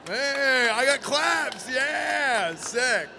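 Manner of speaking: high, loud and elated; real excited cheer. Real, not acted. Host thrilled by claps for his accent, excited. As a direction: crow over the applause.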